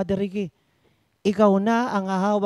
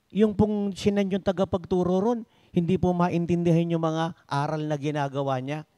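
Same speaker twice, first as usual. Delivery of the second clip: ordinary talk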